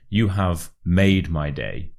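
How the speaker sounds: slow build to a strong "made"; slow example, "made" standing out; stress on MADE. Shown as a demonstration. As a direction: hit "made" and join it to "my". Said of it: "stress the word made".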